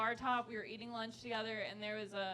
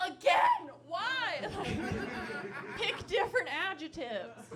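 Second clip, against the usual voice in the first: exasperated; 6 semitones higher, 6 dB louder, slower than usual. Acted. Mocking boyfriend's word choice.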